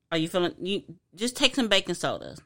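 ordinary talk

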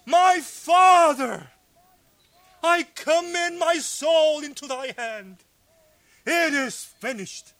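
crying out. Acted. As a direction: call out to the Father with dying force. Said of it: "he cried out".

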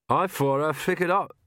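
muffled words round a safety pin; mouth full of pins. Acted. Magrat speaking with a safety pin in her mouth, muffled. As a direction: talk with a pin between the lips.

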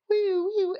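high-pitched "wee" wail; mock siren noise; imitated wailing. Imitates wailing as medic is coming.

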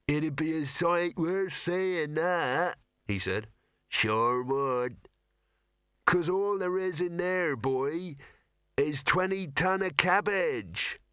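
deadpan rustic, savouring the joke; dry old farmer. Acted. Second farmer replies, dry rustic.

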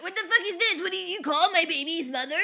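high, loud mock outrage from the father, laughing through it; mother acting out the father's panic; dad's outburst. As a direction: squawk like the busted dad. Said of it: "the interpretation of my voice".

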